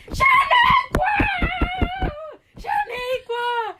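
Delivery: very high, slow yelled name; desperate yell. Retold or imitated. Patient screams at her friend, very high and slow. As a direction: scream her awake while shaking the table. Shaking table, yelling friend awake.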